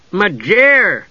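high, falling, helpful interjection; inserted interjection. A narrator's reading. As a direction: pop the name in, pitched high. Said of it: "Tass inserted".

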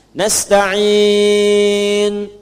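slow "Nasta'in" opening on na, long ending; fathah on na. Shown as a demonstration. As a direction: chant "Nasta'in" starting on a, stretching the in. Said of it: "dibacanya fathah depannya". ("Nasta'in" berawalan fathah, dilantunkan panjang)

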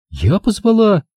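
put-on high, slow, stunned girl's voice; Angela's voice. A narrator's reading. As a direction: spell it out to him in disbelief. Angela's reply. (Анжела изумлённо настаивает, высоким голосом)

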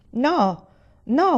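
narrated furious cry, rising on the repeat; shouting. A narrator's reading. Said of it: "urlò lui".

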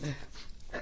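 flustered leafing through pages; nervous mumble. Acted. Nervous actress searching her script, soft and rushed.

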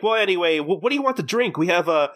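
high, quick change of subject; chirpy hostess. Retold or imitated. Hostess asks customers what to drink, chirpy. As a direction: imitate a hostess breezily taking the order.

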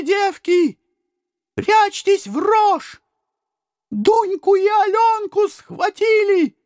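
high, slow, urgent cries of warning; high frantic women's warning; alarmed women shouting. A narrator's reading. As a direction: call out the danger across the village. Village women warn of raiders.

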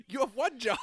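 loud laughing complaint; laughing exasperation. Real, not acted. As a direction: laugh it out in disbelief. Exasperated about atheist pastor.

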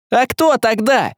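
high, slow shouted question; frantic shout. A narrator's reading. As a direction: yell it in desperation. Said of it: "закричал Василий".

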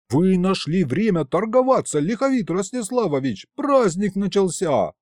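high, urgent scolding; hissed rebuke. A narrator's reading. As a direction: berate him in exasperation. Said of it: "а я зашипел". (возмущённо, на высоких тонах)

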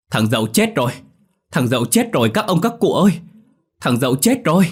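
high, loud desperate shouts; yelling while running. A narrator's reading. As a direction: scream the news as he runs. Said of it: "Nó vừa chạy vừa thét".

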